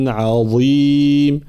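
عظيم with a light ع, drawn out; slow recitation. Shown as a demonstration. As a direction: stretch عظيم, ع kept thin. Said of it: "ترقيق العين".

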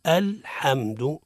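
الحمد drawn out, l held steady; slow الحمد with no bounce on ل; correct still lam. Shown as a demonstration. Teacher repeats the correct form, steady unbounced lam. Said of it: "الصواب هو".

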